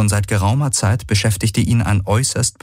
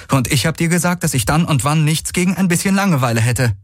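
much higher, strained, called over the ride; called retort; 10 semitones higher, faster than usual. Acted. Kirai answering Garland mid-gallop, high strained retort. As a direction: fire the retort back through a tense grimace.

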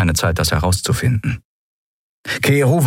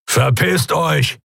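higher, slow creature grumble; menacing grumble; 8 semitones higher, much slower than usual. Acted. Keru threatening the pirates, slow menacing grumble. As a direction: growl them off with a threat. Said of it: "brummte er".

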